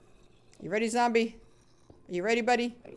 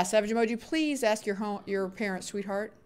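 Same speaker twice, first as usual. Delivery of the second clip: ordinary talk, nothing marked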